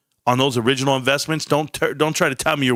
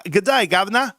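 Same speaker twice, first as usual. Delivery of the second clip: exaggerated British accent, pitched up; mock British accent; 9 semitones higher than usual. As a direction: greet him like a stage Cockney. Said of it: "I don't have all the languages down, all the accents".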